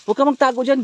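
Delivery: loud startled shouts; alarmed shouting. Real, not acted. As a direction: call out hard as you spot her. Spots girl, shouts at her.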